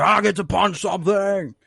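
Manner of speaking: hammed-up eager strongman; excited Wrecker. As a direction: blurt it like an overexcited brute.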